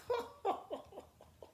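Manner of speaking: high breathless jeering laugh; mocking cackle. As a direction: rude girl cackling at the chickens. Rose laughs at the chickens.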